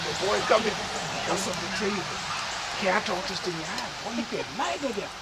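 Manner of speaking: low, soft, reluctant father; hushed, low bad news; confiding father. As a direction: take the son aside and say it low. Father breaks bad news again.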